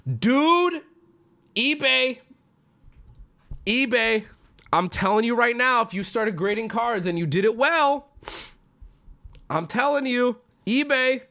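high-pitched, insistent pitch to eBay; emphatic appeal. Real, not acted. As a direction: plead your case, voice pushed up high.

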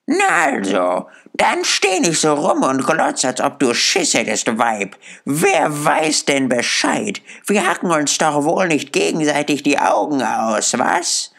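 put-on high-pitched defiant old charwoman; lightly voiced, brazen and challenging; a hint of a coarse, scornful old woman; hostile charwoman. A narrator's reading.

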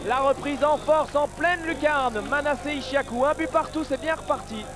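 high, lifted replay call; excited sports commentary. Real, not acted. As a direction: relish the goal replay. Replay of goal.

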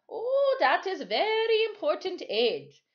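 a Caribbean sailor shrugging it off; Timothy, island sailor. A narrator's reading.

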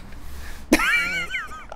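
high squealing laugh. Real, not acted. Laughing at own impression.

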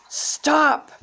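sharp high shout; acted commanding yell. A narrator's reading. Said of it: "someone yelled from the hallway".

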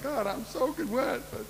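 high, teary mock crying voice; hammed-up tearful squeal at seeing Jesus; crying Peter. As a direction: play Peter welling up like the weepy mom. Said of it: "Peter showed up like that".

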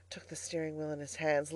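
ordinary delivery, nothing marked

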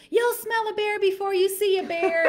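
high-pitched mock call from mom; high retold know-it-all call; mom calling back. Retelling mom calling back to them.

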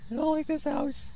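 high-pitched, uneasy whimper; scared. Real, not acted. Player in the haunted house, scared whimper. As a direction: whimper it, really frightened. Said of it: "so scared".

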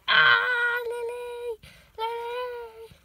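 high, loud startled yelp of the name; startled cry. Real, not acted. Cat runs at owner, high loud startled cry.

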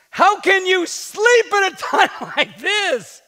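high, loud, slow captain's shout with a laugh. Acted. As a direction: yell at the sleeping man to wake up. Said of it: "he shouted".

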